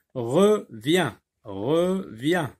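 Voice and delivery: Revient split into re and vient, with a gap; syllable by syllable. Shown as a demonstration. Teacher sounding out two syllables for pupils, separated. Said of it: "deux syllabes".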